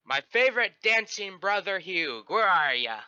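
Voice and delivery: high, hearty call across a room; yelled greeting. Acted. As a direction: burst in hollering with a flourish. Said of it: "and I yell".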